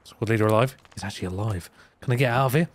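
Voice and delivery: ordinary talk, nothing marked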